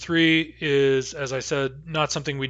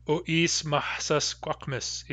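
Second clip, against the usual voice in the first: slow demo pronunciation; 2 semitones higher, 5 dB quieter, slower than usual. Shown as a demonstration. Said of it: "can we say".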